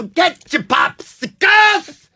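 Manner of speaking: high, slow drawn-out hawker call; vendor call. Retold or imitated. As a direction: stretch it out like a stadium hawker. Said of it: "He has a lisp".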